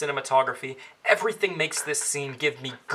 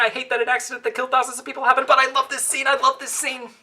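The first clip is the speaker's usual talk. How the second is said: rising higher with each repeat; building excitement; 7 semitones higher than usual. Acted. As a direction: gush more and more. Repeats praise, climbing ever higher.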